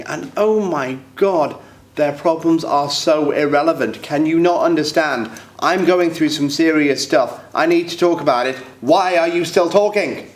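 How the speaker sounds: exasperated rant. Acted. Man fuming inwardly while others keep talking, exasperated. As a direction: stretch the words out in mounting exasperation.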